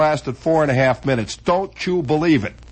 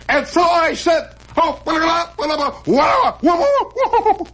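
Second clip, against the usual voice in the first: high, rising blah-blah patter, ending in a shout; desperate comic finish; 12 semitones higher than usual. Retold or imitated. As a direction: push the punchline ever higher. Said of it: "You go".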